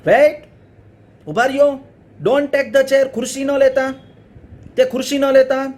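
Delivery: high, loud call to the garbage men; shouting. Acted. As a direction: shout to stop the truck. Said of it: "He shouted". (ઊંચા અવાજે બૂમ)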